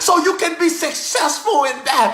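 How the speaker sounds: rhythmic preacher cadence, shouted peaks; full-force preaching. Acted. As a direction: preach it like an old Baptist preacher. Preaching resumes after laughing break.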